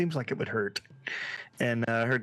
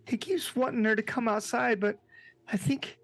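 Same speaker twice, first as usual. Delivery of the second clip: put-on high, hesitant, sad child character; upset Christopher Robin; 10 semitones higher than usual. Christopher Robin explaining to Tigger what happened, sad and hesitant. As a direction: falter through it in a high, upset voice.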